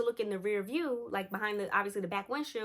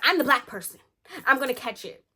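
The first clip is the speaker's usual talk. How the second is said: loud, higher mock alarm; joking protest; 5 semitones higher, 8 dB louder than usual. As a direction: protest loudly that you're the doomed one. Joking protest about horror movie fate.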